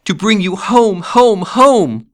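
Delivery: put-on very high, loud child's sing-out; gleeful little girl. A narrator's reading. Little sister bringing her brother home, gleeful. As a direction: chant it with childish delight. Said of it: "clapping her tiny hands and bending down to laugh".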